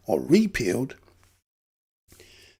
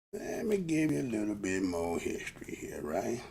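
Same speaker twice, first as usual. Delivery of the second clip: low, soft, stretched-out delivery; lazily drawn, lower and softer restatement; 8 semitones lower, 7 dB quieter than usual. Real, not acted. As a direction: drag "Let me give you a little bit more history here" out, sinking low. Same line repeated low and slow.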